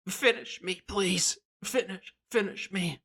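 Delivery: high, begging goblin voice; pleading goblin king. Acted. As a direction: beg in a high voice to be finished off. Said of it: "and to Art he says".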